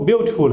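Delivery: "ब्यूटीफुल" said as byoot-ful, no "tee"; two-syllable beautiful. Shown as a demonstration. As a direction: drop the middle vowel of "ब्यूटीफुल": BYOOT-ful. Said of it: "तो ब्यूटीफुल होता है". ("ब्यूटीफुल" दो syllable में, ब्यूट-फुल)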